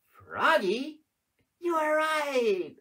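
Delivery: loud, high cheer of froggy; excited reveal. Acted. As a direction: cheer the answer out to the children. Reveals the frog answer.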